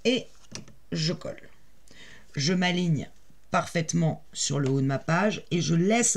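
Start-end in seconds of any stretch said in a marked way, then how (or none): none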